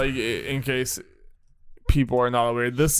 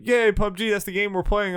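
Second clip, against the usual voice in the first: higher-pitched, imitating a happy crowd; mock excited cheer; 8 semitones higher than usual. Podcaster voicing fans happy in the moment, mock excited. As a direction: put on a hyped fan's cheer.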